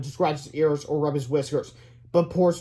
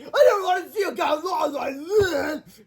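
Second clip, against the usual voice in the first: put-on high, slow wail of a child; sobbing Melvin; 12 semitones higher, 4 dB louder, slower than usual. A narrator's reading. Spanked boy wailing before running out, sobbing. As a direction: blubber it loudly, dragging it out. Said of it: "Melvin ran out of the classroom sobbing".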